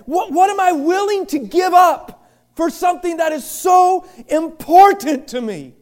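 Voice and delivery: ordinary talk, nothing marked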